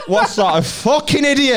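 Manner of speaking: high, loud laughing rant; mocking a pitch to partner through laughter; laughing disbelief. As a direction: crack up mid-insult. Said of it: "I was sat there going".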